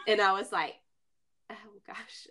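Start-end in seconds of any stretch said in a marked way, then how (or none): 1.5-2.3 hushed, breath-only reaction; flustered whisper. Retold or imitated. Traveler asked to say that word, retold flustered whisper. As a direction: whisper it as if put on the spot. Retells being put on the spot.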